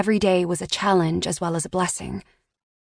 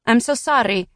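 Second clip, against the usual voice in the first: put-on higher, Italian-accented woman on the phone; thick Italian accent; 4 semitones higher, 3 dB louder than usual. A narrator's reading. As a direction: give her a thick Italian accent, polite and formal. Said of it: "A woman with a thick Italian accent".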